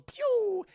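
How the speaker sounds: high, loud "pew"; imitated whoosh. Streamer imitating Clark flying off, high whoosh. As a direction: shoot the sound out high and sustained.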